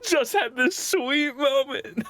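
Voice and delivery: high, slow words through laughter; laughing protest. Real, not acted. Player to the table after a fatal roll, laughing high. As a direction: laugh helplessly while lamenting it.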